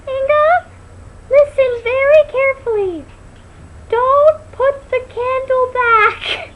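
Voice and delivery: squeaky high pained doctor, slow and loud; really high voice. Retold or imitated. Doctor caught in the bookcase speaks in a very high voice. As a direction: squeeze it out very high and loud, hurt. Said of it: "this really high voice".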